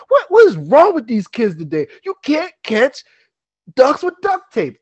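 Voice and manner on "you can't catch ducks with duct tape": exasperated repeat. Acted. Old man repeats.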